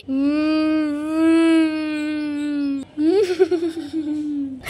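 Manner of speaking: long spooky cackling laugh echoing out; eerie witch cackle. Acted. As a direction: cackle like a witch hiding in the dark. Ghost sound in the dark.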